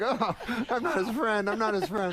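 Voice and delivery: high, mock-alarmed denial; hastily disown someone, pitch way up; retold panicked backpedalling. Mortified teller backpedalling before others.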